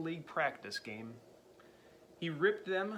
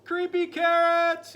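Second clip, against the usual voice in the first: high, loud, slow terrified shout; 15 dB louder, slower than usual. Acted. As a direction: shout it out in fear. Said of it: "he shouted".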